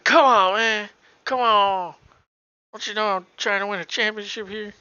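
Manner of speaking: loud, high mock complaint at the refs; imitating yelling at refs. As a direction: whine at the referee, hammed up. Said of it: "He's yelling at the refs".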